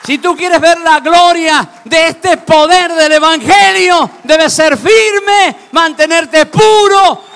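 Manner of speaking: high, loud, slow fervent exhortation; preaching at full force. Acted. Preacher urging the congregation to stay pure, loud and fervent. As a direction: drive every word home to the congregation.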